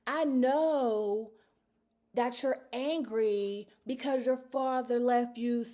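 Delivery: slow, lightly voiced preacher; a hint of a preacher's delivery; quoting the pastor. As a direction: say it like a pastor from the pulpit. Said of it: "one of the things she said was".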